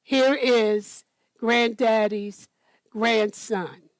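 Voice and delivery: ordinary delivery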